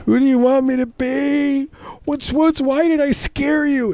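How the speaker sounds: put-on very high, slow wailing child voice; wailing kid. Ghostly boy answering the father, high slow wail. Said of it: "the crying kid answers".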